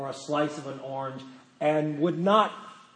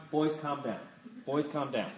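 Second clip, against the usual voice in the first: soft, understated plea for calm; calm grandmother; 2 semitones lower, 9 dB quieter than usual. Retold or imitated. Grandmother to boys chasing with a knife, softly unbothered.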